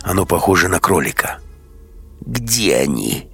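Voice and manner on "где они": slow raspy creature voice; creaky creature voice. A narrator's reading. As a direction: creak it out like an eerie beast. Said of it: "говорит оно вдруг скрипучим голосом".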